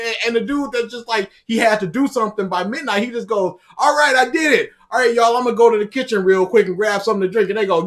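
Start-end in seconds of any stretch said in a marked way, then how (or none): 3.8-7.5 loud, high, fast and breezy; cheerful announcement. Retold or imitated. The survivor telling everyone he's off to the kitchen, breezy and loud. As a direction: toss it off like nothing happened.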